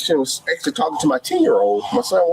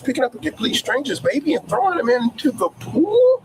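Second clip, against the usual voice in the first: incredulous outrage; 8 semitones higher than usual. Retold or imitated. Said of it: "I'm like".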